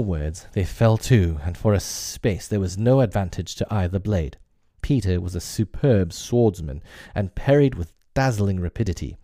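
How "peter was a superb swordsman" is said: ordinary delivery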